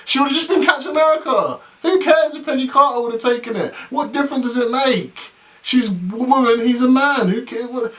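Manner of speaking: high-pitched, incredulous outburst; exasperated rant. Real, not acted. Fan dismissing the Peggy Carter premise, exasperated high rant. Ranting against the premise just read.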